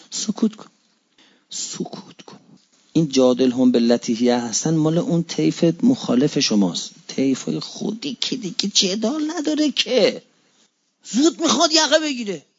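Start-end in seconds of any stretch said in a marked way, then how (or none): 1.5-2.4 soft whisper; whispered hush. Shown as a demonstration. Preacher whispers the Imam's advice to stay silent. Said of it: "امام رضا گفت وقتی دیدی". 8.4-12.4 high, exasperated jab; mock the hothead who grabs collars; mocking exasperation. Preacher mocks a hothead grabbing collars, exasperated and high. Mocking the quick-tempered believer.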